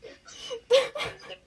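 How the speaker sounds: high giggle at a flub; embarrassed laugh. Real, not acted. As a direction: laugh it off, caught out. Laughs at own mistake.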